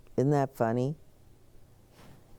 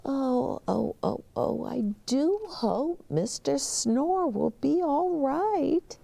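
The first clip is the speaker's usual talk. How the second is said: high, fretting oh oh oh; high worried bellhop; 9 semitones higher than usual. Acted. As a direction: fret in a high voice.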